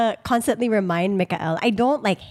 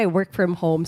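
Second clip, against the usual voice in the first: ordinary delivery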